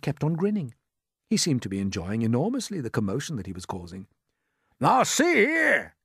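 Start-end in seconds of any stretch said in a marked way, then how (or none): 4.8-6.0 high, loud, drawn-out hail; indignant official shout. A narrator's reading. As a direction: shout it through cupped hands. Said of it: "shouted the chief of police".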